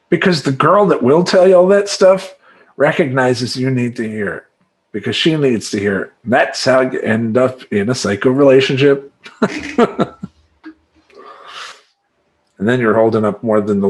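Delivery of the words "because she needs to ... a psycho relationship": usual speaking voice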